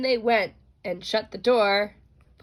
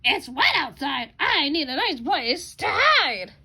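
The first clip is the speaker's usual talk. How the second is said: higher, lightly voiced hen; 6 semitones higher, 4 dB louder than usual. A narrator's reading. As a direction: speak like a fussy little hen. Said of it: "said the hen".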